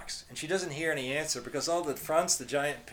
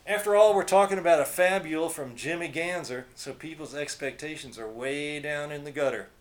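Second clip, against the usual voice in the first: mocking rant; 3 semitones higher, 7 dB louder than usual. A narrator's reading.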